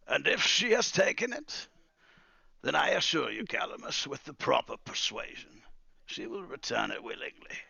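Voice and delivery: high, loud start easing down; smooth threat from an old master; old wizard, veiled menace. Acted. Wizard assuring Calamus she will be persuaded, veiled menace.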